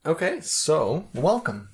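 slow, high host welcome; podcast host voice. Pretend. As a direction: welcome listeners like a show host. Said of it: "Start with your podcast voice".

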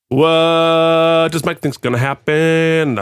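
slow, drawn-out segment title; announcer voice. Pretend. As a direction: announce it like a show bumper. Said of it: "but we should".

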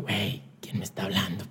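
soft unvoiced puzzled question; whispered wonder. On the bus staring at missed calls, whispered. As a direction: wonder quietly who's calling.

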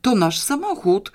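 high, loud horrified exclamation; urgent, appalled outburst; astonished horror. A narrator's reading. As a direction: exclaim it in stunned dismay. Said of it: "wykrzyknął zduszonym szeptem, pełnym zdumionej zgrozy".

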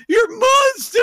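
hammed-up horrified accuser; mock outrage. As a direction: shriek it like a scandalised bystander. Mocking outraged onlookers.

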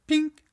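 high, loud "ping"; a sudden bright-idea chime, sung out high; imitated ping. Shown as a demonstration. Imitates solution suddenly arriving.

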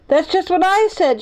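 high, excited mock shout; excited exclamation without full volume. A narrator's reading. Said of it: "shouted Benny".

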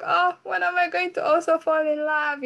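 high, swooning sigh of a lovestruck listener; dreamy longing. Retold or imitated. Imitating a listener dreaming of love, high swoon. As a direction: float it up, lost in a love song. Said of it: "you're like".

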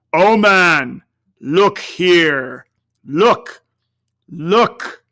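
loud, urgent otherworldly call; ghost's exclamation. A narrator's reading. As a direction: cry out to make him look down. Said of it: "exclaimed the ghost".

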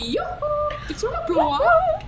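high, hooting falsetto catchphrase; hammed-up cartoon voice, loud and laughing; mimicking Frozen character. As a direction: call it out like a cartoon shopkeeper. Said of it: "What's the one in Frozen?".

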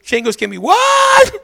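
hammed-up commercial shout; mock ad shout. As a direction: bellow it like the old lady in the ad. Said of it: "She said".

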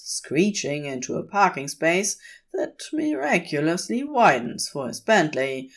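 usual speaking voice

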